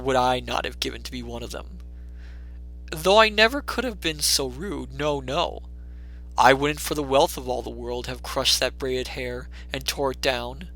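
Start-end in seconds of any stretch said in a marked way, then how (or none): none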